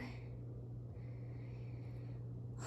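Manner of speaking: long breathy exhale; heavy sigh. Real, not acted. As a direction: let out a tired sigh. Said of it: "I'm out of breath".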